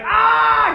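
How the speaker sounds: very high, loud scream of pain. Retold or imitated. Tahu being zapped by the swords, loud pained scream. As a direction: cry out like you're being zapped.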